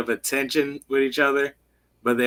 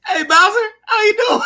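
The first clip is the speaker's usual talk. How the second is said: much higher, loud, laughing hello; cheery greeting; 4 dB louder, faster than usual. Retold or imitated. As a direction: greet him way too cheerfully. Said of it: "He goes".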